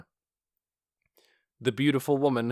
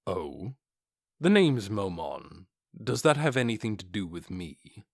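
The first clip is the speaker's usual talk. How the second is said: low, calm dark warrior voice; cool, unbothered armored warrior; Momon, low and cool; 3 semitones lower than usual. Acted.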